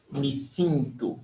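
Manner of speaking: "sinto" said with nasal i, not e; vowel change demo. Shown as a demonstration. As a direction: show the i in "sinto". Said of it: "We change the E for the I".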